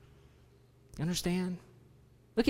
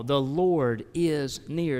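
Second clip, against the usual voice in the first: slow reverent reading; much slower than usual. Acted. Reading verse slowly.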